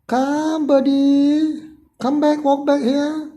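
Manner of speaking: lifted, carrying voice for a bird on the sill; coaxing a bird. Real, not acted. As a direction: call sweetly to a bird to lure it back.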